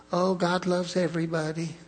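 lightly mocking a gentle TV preacher; sugary mock of a feel-good sermon; a touch of a soft-spoken TV evangelist, teasing. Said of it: "He wasn't Joel Osteen then".

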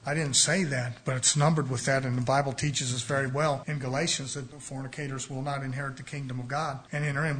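ordinary talk, nothing marked

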